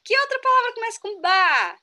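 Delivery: put-on stretched, slow sing-song quiz to a small child; like a playful adult quizzing a toddler; slow teacher-to-child voice. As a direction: coax a little kid, drawing out each word, rising high at the end. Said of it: "Eles falavam assim".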